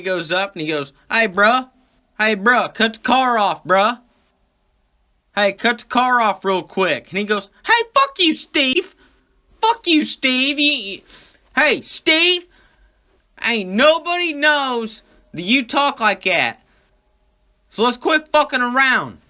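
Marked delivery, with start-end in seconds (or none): 1.1-7.1 high, loud bruh-style call; imitated street-talking cop. Cop telling driver to cut the car off, loud high street-talk impression. 7.6-19.2 very high, loud hammed-up yelling; mock angry outburst. Driver calling out Steve's fake talk, high loud mock anger. Driver angrily calls out cop's act.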